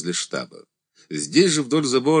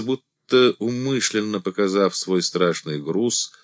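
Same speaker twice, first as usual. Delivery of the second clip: ordinary delivery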